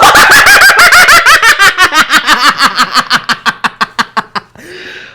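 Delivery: long high shrieking laugh; screaming laugh. Real, not acted. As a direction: howl with laughter. Laugh after shouting.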